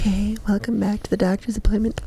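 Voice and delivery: soft, hushed role-play doctor; softly spoken parody of ASMR; mock ASMR. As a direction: play a gentle-voiced ASMR doctor, quietly.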